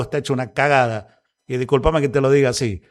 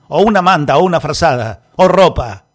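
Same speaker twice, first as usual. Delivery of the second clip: louder, rising indignation over each item; angry outburst; 7 dB louder than usual. Real, not acted. Radio host shouting at listeners about people begging for blankets, indignant. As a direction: hammer each need louder.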